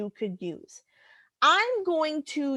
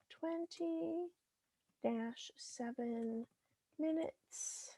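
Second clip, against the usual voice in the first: very soft, half to oneself; murmuring while typing; 6 semitones higher, 17 dB quieter, slower than usual. Real, not acted. Teacher typing out the answer, murmured to self. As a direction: say it under your breath as you type.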